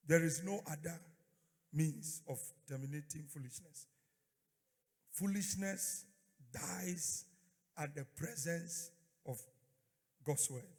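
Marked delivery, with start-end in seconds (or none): none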